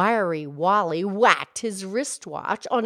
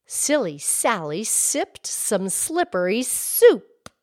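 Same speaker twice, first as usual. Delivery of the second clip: slow, deliberate tongue twister; host models the twister for her friend, slow and clear; slow tongue twister demo; 2 semitones higher than usual. Shown as a demonstration. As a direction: lean on every S, slowly. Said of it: "here's one".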